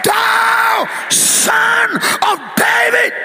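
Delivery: slow, high, drawn-out scream. Acted. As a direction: scream each word out to be heard. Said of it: "Come on, scream".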